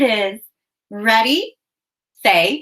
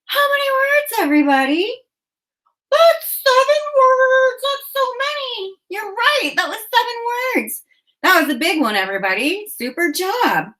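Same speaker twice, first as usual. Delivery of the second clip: high excited puppet bird, singsong; Ollie puppet voice; 13 semitones higher than usual. Acted. Puppet bird cheering the kids on, high and excited. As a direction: chirp the praise like a thrilled puppet.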